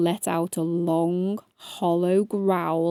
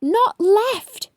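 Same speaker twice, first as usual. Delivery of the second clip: high, louder yell; 11 semitones higher, 5 dB louder than usual. A narrator's reading. Ladybird correcting hedgehog, loud yell. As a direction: yell it, exasperated. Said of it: "yelled Stripey".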